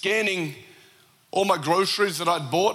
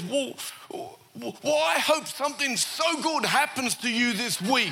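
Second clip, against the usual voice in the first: high-pitched, halting start, then heartfelt; mock choked-up thanks, lightly acted; imitating tearful gratitude; 7 semitones higher than usual. Tearful shopper blessing the man who paid, moved. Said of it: "she got tears rolling down her eyes".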